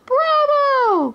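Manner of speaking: high, delighted cries of bravo, climbing each time; overjoyed cheering. A narrator's reading. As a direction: cheer as if your hero just won. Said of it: "cried Maid Marian overjoyed".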